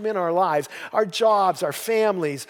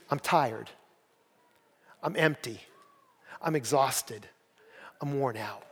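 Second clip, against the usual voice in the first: weary; 4 semitones lower, 8 dB quieter than usual. Retold or imitated. Voicing weary churchgoers' answers.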